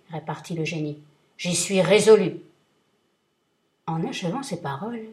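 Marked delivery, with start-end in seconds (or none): 1.4-2.4 booming, much louder threat; thundering vengeful genie; terrible genie voice. Acted. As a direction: roar it down at him like a giant. Genie decides.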